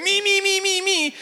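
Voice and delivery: very high, squealed imitation of eager kids; high-pitched mock schoolkid eagerness; excited kids. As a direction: mimic kids clamouring to be picked. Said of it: "these kids now get so excited".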